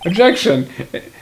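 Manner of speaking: usual speaking voice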